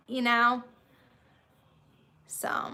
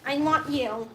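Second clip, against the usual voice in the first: ordinary delivery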